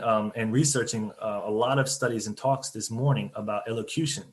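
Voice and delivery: usual speaking voice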